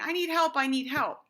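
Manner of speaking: high, loud, whiny plea for help; mock-whiny child begging for help; mock whining. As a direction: whine it like a kid giving up too soon. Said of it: "but not in a whiny voice".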